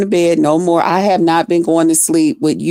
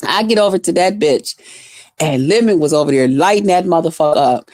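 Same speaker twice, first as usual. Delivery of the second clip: normal talking voice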